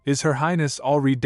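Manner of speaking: usual speaking voice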